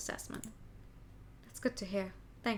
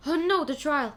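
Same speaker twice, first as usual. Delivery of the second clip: sudden alarm; 4 semitones higher, 8 dB louder than usual. Acted. Realizing he missed the trial.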